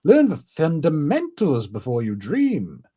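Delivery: high, scornful instructor voice; hammed-up indignant telling-off; Brook. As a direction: scold like an exasperated squad leader. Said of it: "I'm gonna say it's Brooke".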